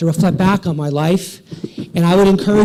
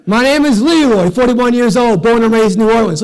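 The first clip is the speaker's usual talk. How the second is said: acted booming introduction, pitched high; big hearty stranger's holler; loud introduction; 6 semitones higher, 8 dB louder than usual. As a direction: introduce yourself at full volume. Big stranger introduces himself loudly.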